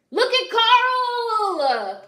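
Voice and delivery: drawn-out yell, high and loud; a slow, howling shout of disgust; outraged yell. Real, not acted. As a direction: scream his name at the screen in outrage. Outraged at the villain onscreen.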